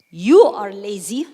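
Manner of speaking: loud blunt accusation; harsh accusation. Shown as a demonstration. Teacher showing the hurtful way, loud and blunt. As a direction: snap it out like an attack. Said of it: "instead of saying".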